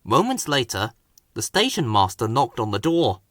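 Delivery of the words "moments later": usual speaking voice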